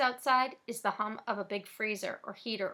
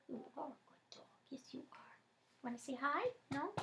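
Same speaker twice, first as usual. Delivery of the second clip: whisper to dog; 4 semitones higher, 13 dB quieter than usual. Real, not acted.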